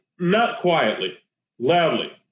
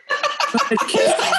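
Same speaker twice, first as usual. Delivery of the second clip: squeaky; much faster than usual. Retold or imitated. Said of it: "she was like".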